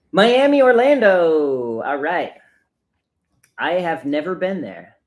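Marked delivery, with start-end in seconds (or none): 0.1-1.8 loud, high holler with a long stretched ending; big drawn-out cheer, sliding down at the end; hyped shout-out. Real, not acted. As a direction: belt out the place name like a stadium greeting. Reading out a viewer's hometown excitedly.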